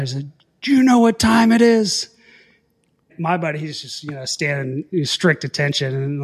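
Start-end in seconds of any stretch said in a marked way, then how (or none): 0.6-2.1 loud, high barked question; retold yell. Old officer yelling at two late students. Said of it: "he starts yelling".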